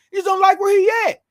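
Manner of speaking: high shouted defence. Real, not acted. Host defending the player to fans, shouting.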